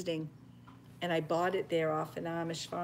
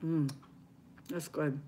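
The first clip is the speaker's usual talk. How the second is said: normal talking voice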